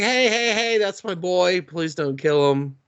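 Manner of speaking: high-pitched retold plea, easing down; comic imitation of a pleading father; mock pleading. Dad begging hunters to spare his boy, mock pleading.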